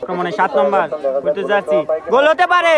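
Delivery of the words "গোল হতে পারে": sudden high excited call; excited commentary. Real, not acted. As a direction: call the chance with a jolt of excitement. Goal-chance call.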